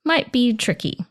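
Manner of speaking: high, hesitant, tentative let-down; gentle hedging refusal. Shown as a demonstration. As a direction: soften the no with a high, wavering hesitation. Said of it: "But it's more like".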